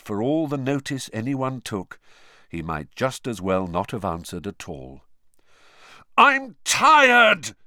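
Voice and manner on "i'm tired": loud, high exasperated bellow; full-voiced fed-up shout. A narrator's reading. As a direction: explode after being pestered too long. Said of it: "he bellowed finally".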